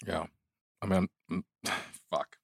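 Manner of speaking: quiet, tearful voice near tears; holding back tears, real; a real catch in the voice; choked up. Real, not acted. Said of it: "Tearing up hearing that".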